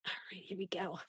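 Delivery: unvoiced whisper. Real, not acted. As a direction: breathe it out under your breath. Whisper to dog.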